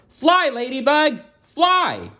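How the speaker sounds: high, urgent little-worm voice; Wormy urging. Acted. Wormy urging the stuck ladybugs in a high voice. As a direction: call out to them like a tiny worm. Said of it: "Wormy said".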